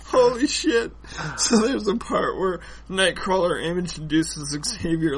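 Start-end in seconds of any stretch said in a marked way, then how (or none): none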